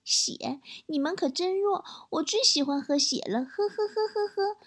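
soft, high mock-sinister child; chuunibyou chubby kid. Little boy taunts adults afraid of blood, hammed-up sinister sneer. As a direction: sneer softly, ending in a fake villain chuckle. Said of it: "呵呵笑了两声".

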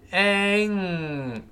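slow demo of the nasal ending; velar nasal. Shown as a demonstration. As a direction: stretch the "ng" at the back of the throat. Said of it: "那舌根要拱上去了".

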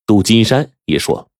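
ordinary delivery

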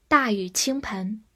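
usual speaking voice

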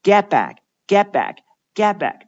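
"get back" with T and K stopped silently; unreleased endings. Shown as a demonstration. Teacher showing K is not sounded, unreleased "get back". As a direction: cut "get" and "back" short, no release on the last consonant. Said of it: "也仅仅只做出爆破的动作".

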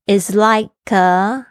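Is like a said slowly, like and a run together; slow teacher model linking like and a; linked demo. Shown as a demonstration. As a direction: blend like a into one smooth sound. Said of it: "跟那like可以连音在一起哈".

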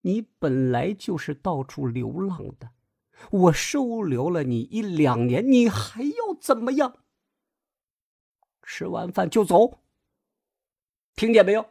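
reproach, then demand obedience sharply; stern scolding. A narrator's reading. Grandma ordering her daughter-in-law to leave, stern, rising anger. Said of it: "厉声吼道".

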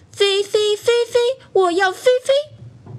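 high-pitched acted wail of a toy doll; crying wail. A narrator's reading. Doll left behind calls for Feifei, acted crying wail. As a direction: wail childishly, held high, without really shouting. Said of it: "弟弟就哭着喊着".

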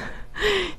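a brief high real laugh; quick real chuckle; short laugh. Real, not acted. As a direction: laugh it off lightly. Laugh after comment on an old review.

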